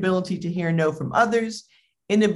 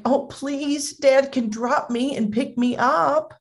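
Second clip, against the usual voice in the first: put-on high, begging child voice; acted plea to an overprotective mom; pleading kid; 8 semitones higher, slower than usual. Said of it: "And he's like".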